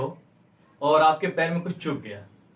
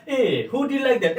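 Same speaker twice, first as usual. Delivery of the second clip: slow, high, appalled "who did like that"; drawn-out grossed-out question; disgusted ew; 6 semitones higher, much slower than usual. Shown as a demonstration. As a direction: recoil in disgust. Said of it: "परिवर्तन कैसे करेंगे".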